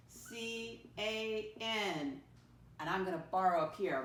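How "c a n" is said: very slow, letter by letter; spelling aloud. Shown as a demonstration. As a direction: spell it out with long gaps for pupils. Spelling a word for pupils.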